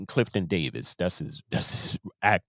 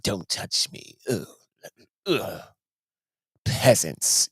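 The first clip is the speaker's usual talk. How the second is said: breathy, disdainful put-on voice; mock stuck-up star brushing off fans; snobby celebrity; slower than usual. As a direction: sneer at fans beneath you.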